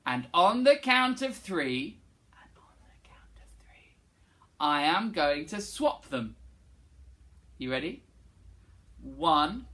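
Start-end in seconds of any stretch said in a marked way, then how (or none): 2.3-4.0 slow soft whisper. Shown as a demonstration. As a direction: breathe it in a whisper. Whispered echo.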